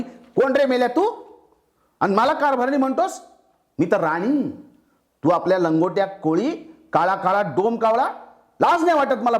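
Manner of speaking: high, scornful yelling queen; angry shout. Acted. Queen wife yells at her fisherman husband, high angry shout. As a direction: shout him down with contempt. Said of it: "ती त्याच्यावर एकदम ओरडली".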